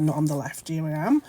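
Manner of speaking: ordinary talk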